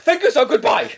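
very high, mock exasperated sign-off; frustrated scammer. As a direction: mimic a caller at the end of his tether. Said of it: "more and more frustrated with me".

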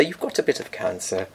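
soft, low, soothing doctor; comforting doctor voice. Retold or imitated. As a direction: reassure like a kindly family doctor. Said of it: "Very good voice, comforting".

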